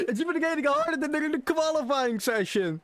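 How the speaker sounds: high, laughing through the words. Real, not acted. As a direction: laugh in disbelief while talking. Disbelief at hard race conditions.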